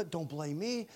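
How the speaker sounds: soft, hushed disclaimer; mock innocence. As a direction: back away from blame very softly. Adam acted dodging blame.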